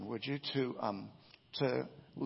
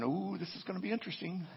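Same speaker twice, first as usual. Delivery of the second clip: lightly mocked intrigue, pitched up; higher, curious aside; intrigued; 6 semitones higher, 3 dB quieter, faster than usual. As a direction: relish it like juicy eavesdropping. Retelling Tony's intrigued reaction.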